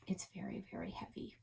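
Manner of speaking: breathy whispered emphasis; whispered very very heavy. A narrator's reading. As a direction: hush it out as if straining. Narrator whispers heaviness.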